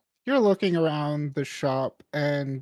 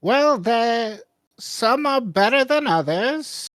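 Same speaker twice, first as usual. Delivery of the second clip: high, louder shopkeeper defending the wares; shopkeeper character; 8 semitones higher, 5 dB louder than usual. Acted. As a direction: answer the customer with high, swooping emphasis. Shopkeeper answers a doubtful customer.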